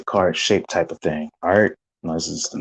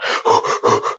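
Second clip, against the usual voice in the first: gasping. Retold or imitated. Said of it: "Everybody's going".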